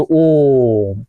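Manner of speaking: ओम stretched very slow, the vowel held long; drawn-out om. Shown as a demonstration. Teacher shows students the longest vowel, drawn-out ओम. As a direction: hold ओम out long and slow like a chant. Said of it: "दीर्घ से भी ज्यादा समय लगेगा".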